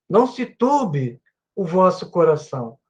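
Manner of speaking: normal talking voice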